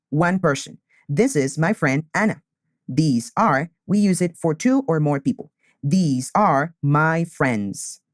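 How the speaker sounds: usual speaking voice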